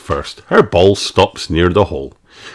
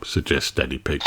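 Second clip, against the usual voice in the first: ordinary delivery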